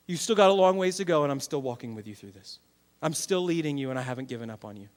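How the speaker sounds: low, gentle, softening voice; patient reassurance. Acted. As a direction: voice a patient God reassuring his child. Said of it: "God has been unbelievably patient".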